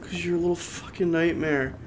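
low quiet growl; low menacing mutter. Real, not acted. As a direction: mutter it darkly.

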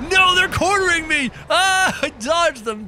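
high, loud mock panic; alarmed yelling. Player dodges cornering monsters, high panicked yell in play. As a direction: cry out as if trapped.

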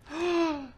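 high frightened gasp; sharp gasp. Real, not acted. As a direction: gasp in sudden fear. Sudden fright in game.

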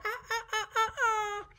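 high orangutan hoots and chatter; monkey call. Shown as a demonstration. Showing toddlers how orangutans go, high monkey hooting. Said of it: "a kind of monkey, and they go".

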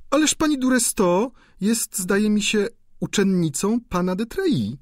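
sly duchess. A narrator's reading. Duchess slyly corrects the student about the countess, gossiping. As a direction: drop the gossip with sly poise.

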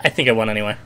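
ordinary talk

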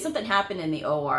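ordinary talk

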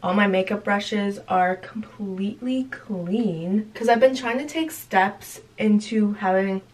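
ordinary delivery

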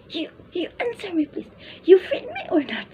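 put-on high, insistent child voice with a laugh; demanding child. Ward presses his nanny to answer, high bossy child voice. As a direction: push like a bossy little boy.

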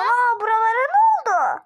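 loud, high mock astonishment; feigned shock. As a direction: fake amazement at the mess you made. Prankster feigns shock at mess. (yapmacık, yüksek sesli şaşkınlık)

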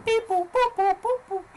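high robotic alien beeping. Acted. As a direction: beep it out like a little alien. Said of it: "that's my alien voice".

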